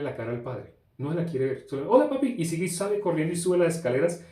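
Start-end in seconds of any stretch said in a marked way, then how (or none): 1.9-2.4 put-on high, loud, rushed "Hola, papi" as the boy slips past; a guilty boy chirping hello and running off; a son ducking his father with a bright hello; son's quick greeting.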